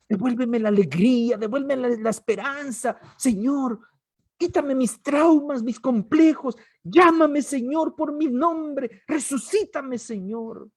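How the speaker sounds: loud, high pleading prayer; impassioned pleading prayer; fervent prayer. Acted. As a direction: cry out to God with everything.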